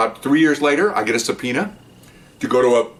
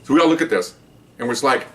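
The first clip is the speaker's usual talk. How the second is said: usual speaking voice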